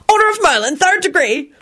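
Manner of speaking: high, boastful title; announcing. A narrator's reading. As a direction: present the honour grandly. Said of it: "Henry announced".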